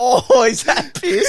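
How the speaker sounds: very high, loud, slow squeal through a laugh; mock horror. The friend imitating the mate realising it's piss, squealing. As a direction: shriek it in disbelief, laughing.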